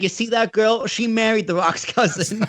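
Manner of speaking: high, animated tell-all voice; eager pointing-out. Retold or imitated. Retelling showing family The Rock's in-law, eager high voice.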